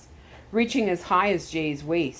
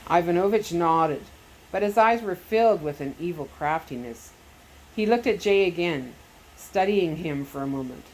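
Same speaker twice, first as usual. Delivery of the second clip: plain reading voice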